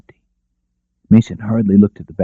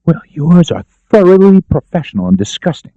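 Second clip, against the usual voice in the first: sharp, higher retort; snapped; 10 semitones higher, 5 dB louder than usual. Acted. Daphne snapping back at Mason, higher and sharp. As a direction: bite the words back at him. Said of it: "she snapped".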